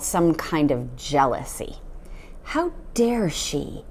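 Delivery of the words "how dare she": slow, hushed seething; jealous father muttering; resentful. A narrator's reading. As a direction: seethe it under the breath. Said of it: "he seemed to be saying".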